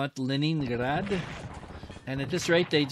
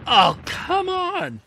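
slow, much higher and louder come on; exasperated shout; 9 dB louder, much slower than usual. Real, not acted. Player protesting an enemy unit that should be out of shells. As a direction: protest the unfair result.

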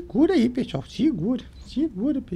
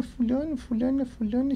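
usual speaking voice

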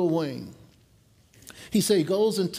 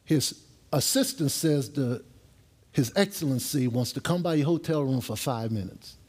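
ordinary talk, nothing marked

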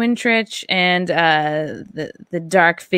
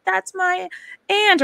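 excited claim; 12 semitones higher, slower than usual. Retold or imitated. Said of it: "you'd be like".

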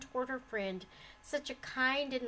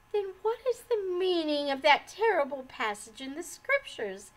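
high, loud anguished question; distraught outcry; despairing; 5 semitones higher, 6 dB louder than usual. A narrator's reading. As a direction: demand an answer in despair. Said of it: "asked Umbridge despairingly".

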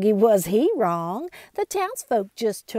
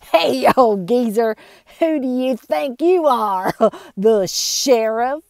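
loud jeering with laughs; mocking laughter; 4 semitones higher, 9 dB louder than usual. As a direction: laugh in his face.

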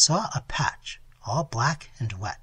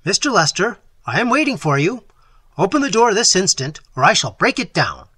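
louder, higher threat through a locked door; commanding shout; 6 semitones higher, 9 dB louder than usual. Acted. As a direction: bark the ultimatum, loud and higher.

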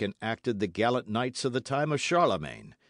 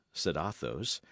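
plain reading voice

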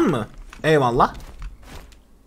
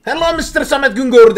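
hello in a hint of an Indian accent, louder; mock Indian accent; 5 semitones higher, 5 dB louder than usual. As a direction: imitate the stranger's Indian-accented greeting. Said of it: "Hintli, Hintli". (Hint aksanıyla taklit)

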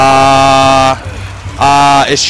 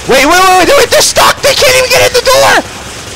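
high, loud gleeful yelp; excited squeal; 3 dB louder than usual. Real, not acted. Gamer spots monsters stuck in the doorway, high excited yelps. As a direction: burst out in disbelieving delight.

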